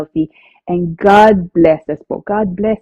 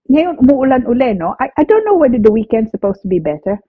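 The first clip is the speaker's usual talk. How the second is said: ordinary talk, nothing marked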